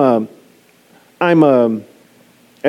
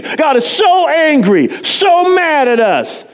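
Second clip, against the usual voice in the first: mock angry shout; 7 semitones higher, slower than usual. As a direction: ham up God's anger ironically. Ironic mock anger about God.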